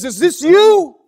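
high, booming accusatory shout; thunderous full-volume accusation; thundering voice. Acted. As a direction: roar the question as judge. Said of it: "that voice thunders again".

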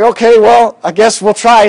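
retold reluctant agreement.

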